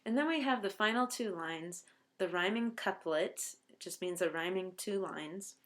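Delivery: normal talking voice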